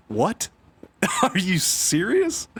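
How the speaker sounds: high, slow mock shock; mock disbelief. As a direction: gasp out the disbelief with a laugh. Mock disbelief at no DLC news.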